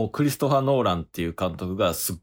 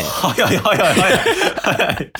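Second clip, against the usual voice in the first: laughing; 9 semitones higher, 8 dB louder, slower than usual. Real, not acted. Laughing at sudden samurai switch.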